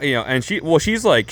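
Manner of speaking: usual speaking voice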